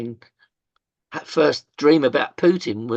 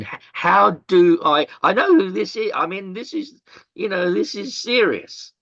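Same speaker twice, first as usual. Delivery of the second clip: higher, tense rush of worried thinking; anxious inner thought; 7 semitones higher, 4 dB louder than usual. Retold or imitated. Man staring at a ringing phone, nervous and raised.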